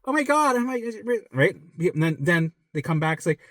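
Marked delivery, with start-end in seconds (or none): 0.0-1.2 high, flustered imitation of a sufferer; put-on anxious disbelief; mock alarm. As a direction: act out someone starting to panic. Acting sufferer alarmed by timeline.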